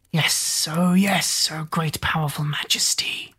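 whispered, fawning little creature; grovelling imp. Acted. Imp Googe bowing to his queen, fawning whisper. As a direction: hiss your devotion to the majesty.

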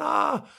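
very high mock shriek "ah"; imitated scream. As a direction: let out her panicked shriek. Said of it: "screaming, shouting".

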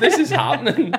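high, laughing insistence. Real, not acted. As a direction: squeal it through a laugh. Insisting on the racing joke.

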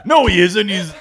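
high, loud mock outrage; shrill overlapping reality fight. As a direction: shriek it like a defensive mom. Mock fight defending human son.

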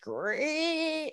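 long high-pitched screech noise; softly imitated screech, stretched out. Shown as a demonstration. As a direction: draw out a high, thin screech. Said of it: "I heard a screech".

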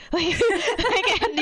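laughing through the words, pitch jumping up. Real, not acted. Host laughing at her own surprise at the book. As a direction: giggle out the filler words.

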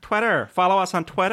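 high, loud hammed-up American Twitter; exaggerated American accent. Shown as a demonstration. Podcaster plugging Twitter, hammed-up American accent. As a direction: belt it out in a put-on American accent. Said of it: "say with an American accent".

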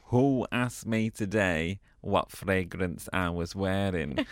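low, soft, slow conspiratorial plan; husband quietly plotting on the landing; hushed scheming. Retold or imitated. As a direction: keep it down so mum can't hear.

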